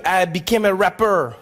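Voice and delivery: mock French-accented boast; deadpan French-accented life story. French immigrant explaining how he made money, mock French accent. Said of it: "the only French trap rapper".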